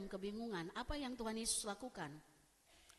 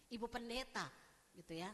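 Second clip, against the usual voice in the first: ordinary delivery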